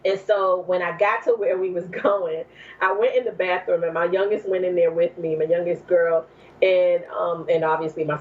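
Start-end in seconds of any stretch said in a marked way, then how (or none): none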